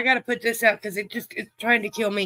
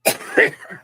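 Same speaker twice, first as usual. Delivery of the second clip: sharp, harsh real cough; real coughing fit; 13 semitones higher, 5 dB louder than usual. Real, not acted. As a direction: hack out a hard cough. Smoker coughing fit on stream.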